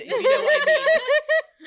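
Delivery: high, loud giggling repeat; laughing mock quote. Host laughing while repeating the guest's innuendo. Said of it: "You ruined two lines".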